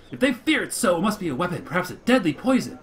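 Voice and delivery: loud, high, urgent rant about fruitcake; zealous monster orator. Acted. Mopsy warning the elders, high and loud. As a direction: whip up the crowd in alarm. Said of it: "Mopsy goes on".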